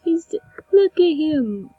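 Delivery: high, loud, doting gush over a cute thing. Real, not acted. Gamer gushing at her tiny in-game flamingo, high and doting.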